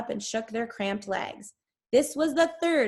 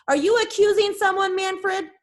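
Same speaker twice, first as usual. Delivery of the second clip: higher, louder woman's voice cutting through; reporter woman; 10 semitones higher, 7 dB louder than usual. A narrator's reading. Reporter pressing Manfred Bloor in a crowd, high loud call. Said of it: "a woman's voice broke through".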